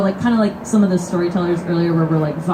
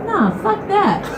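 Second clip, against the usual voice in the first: high, laughing flat refusal; openly blunt; 12 semitones higher, slower than usual. Retold or imitated. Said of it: "openly says".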